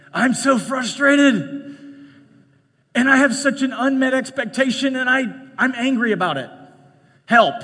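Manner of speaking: demo of frustration. Shown as a demonstration. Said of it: "here's a great how-to for you".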